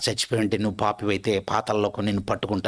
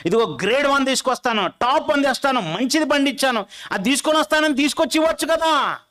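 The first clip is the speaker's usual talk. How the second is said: Cain complaining to God; 9 semitones higher than usual. Acted.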